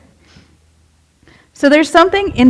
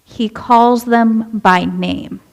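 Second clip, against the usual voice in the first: very slow, each word spaced out; a preacher slowing down to stress the point; much slower than usual. Shown as a demonstration. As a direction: let every word land slowly. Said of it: "Now slow down".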